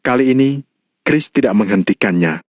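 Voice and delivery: usual speaking voice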